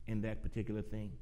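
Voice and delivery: lifted, projected pulpit voice; preaching. Real, not acted. Preaches an enthusiastic sermon opening.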